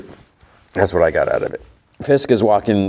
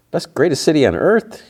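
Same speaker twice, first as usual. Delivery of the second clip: incredulous; 8 semitones higher than usual. Real, not acted. Vlogger doubting New York is the greatest city, incredulous. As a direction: pitch jumps high in mock disbelief. Doubting a character's boast again.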